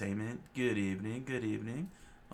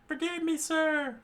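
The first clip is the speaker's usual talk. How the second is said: much higher squeaky plea; begging marine; 5 dB louder than usual. Acted. Marine begging forgiveness, high and squeaky. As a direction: beg in a squeak.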